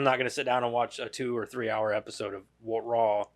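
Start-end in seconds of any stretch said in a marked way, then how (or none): none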